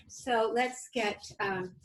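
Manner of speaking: ordinary delivery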